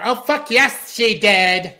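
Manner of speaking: high, loud put-on cartoon voice; Roger voice. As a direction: squeal it out like a gleeful cartoon alien. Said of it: "Check out Roger".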